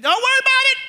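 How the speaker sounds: high, sudden mock-dad yell; irritated dad shout. Dad answers mom's doubt about the fit, irritated shout. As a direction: fire it back high and defensive.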